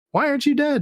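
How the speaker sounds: very high mock Italian accent; silly Italian accent. Podcaster quoting a baffled Nazi, high mock Italian accent. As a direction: squeal it in pretend Italian. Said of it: "gonna do Italian accents for German Nazis".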